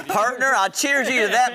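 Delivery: mock cowboy. Cowboy banter in country gear.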